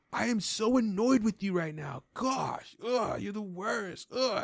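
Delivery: slow, high whiny groaning; annoyed kid. Retold or imitated. Imitating a teen whining at his dad in the store. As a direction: huff like a sulky teenager. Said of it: "he's just like".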